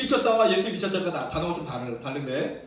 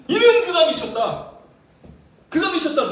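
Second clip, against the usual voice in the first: accusing shout; 7 semitones higher, 8 dB louder than usual. Retold or imitated. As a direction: hurl it like scandalized relatives. Relatives calling Jesus mad.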